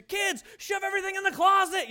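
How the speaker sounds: put-on high, loud hurried order to the kids; yelling at the kids. Mom shouting orders at kids before company comes, loud and high. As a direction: bark it like a frazzled mom before guests arrive.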